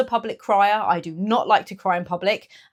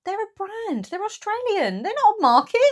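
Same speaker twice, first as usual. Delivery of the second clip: much higher, exasperated explaining; exasperated correction; 13 semitones higher than usual. Real, not acted. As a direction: set him straight, fed up. Exasperated at his mistake.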